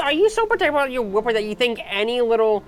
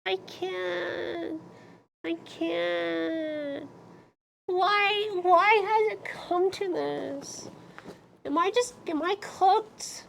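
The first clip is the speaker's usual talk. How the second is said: mock despair; 4 semitones higher, 8 dB quieter, slower than usual. Despairing over cringe.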